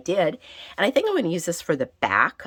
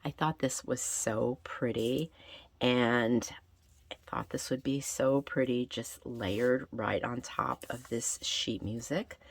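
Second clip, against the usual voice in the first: ordinary talk, nothing marked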